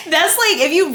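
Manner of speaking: high, talking through laughter; laughing. Real, not acted. Host delighted by the retold insult, laughing. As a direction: try to speak while cracking up.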